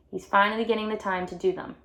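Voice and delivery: plain reading voice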